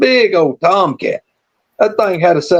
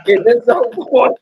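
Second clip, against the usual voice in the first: ordinary delivery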